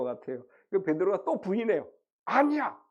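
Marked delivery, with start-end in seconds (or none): 2.2-2.8 high, abrupt acted denial; startled denial. Peter denying again with a sinking heart, startled denial. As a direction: blurt it out, as if caught. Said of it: "얼마나 가슴이 뜨끔했을까".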